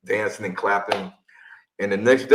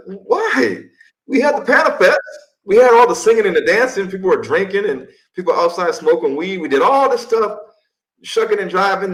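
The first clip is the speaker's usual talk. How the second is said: much higher and louder, outraged rant; indignant; 10 semitones higher, 5 dB louder than usual. Real, not acted. Telling listeners how the government shut down his panel, higher louder outrage. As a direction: protest the injustice, voice climbing.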